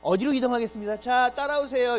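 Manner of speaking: a guide moving tourists on; mock tour guide. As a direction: announce the next stop to the group. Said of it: "이러고 간다며요".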